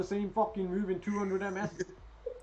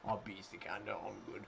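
mock boast; 9 semitones lower, 13 dB quieter than usual. Mocking opponent's boast.

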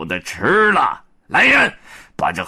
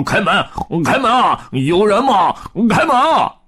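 high, louder shouting to open up; calling at the door; 9 semitones higher, 4 dB louder than usual. Acted. Pigsy pounding on the cave door, calling loudly. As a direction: bang and call rising higher. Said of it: "咚咚咚地敲门道".

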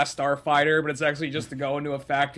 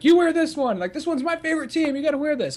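hammed-up fan pushing his team's jersey; eager Irish fan; 11 semitones higher than usual. Irish guy handing out jerseys.

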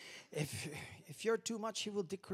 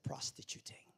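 a soft breathy whisper of Prostituting; hushed whisper; 6 dB quieter than usual. Retold or imitated. God telling pastor in vision, whispered. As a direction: breathe it like a secret.

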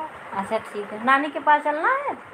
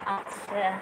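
ordinary delivery

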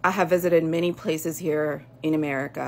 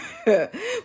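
high mocking laugh. Real, not acted. Scoffing laugh at rivals.

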